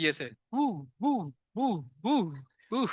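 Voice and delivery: mock whoosh of jumping molecules; teacher's playful sound effects. Shown as a demonstration. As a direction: imitate molecules hopping with little woo sounds. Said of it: "style है यार पढ़ाने का".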